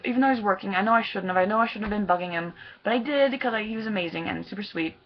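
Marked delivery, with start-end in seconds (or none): none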